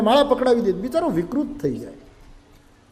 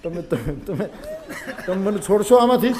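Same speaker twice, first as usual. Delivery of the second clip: mock sobbing; 2 semitones lower than usual. A narrator's reading. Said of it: "રોવા માંડ્યા, હિબકે ચડ્યા".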